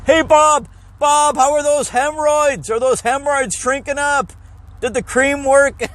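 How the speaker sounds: high, blaring announcer-style doctor; booming doctor voice. Retold or imitated. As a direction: broadcast the patient's private problem to the whole building. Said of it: "you can hear him throughout the whole fucking building".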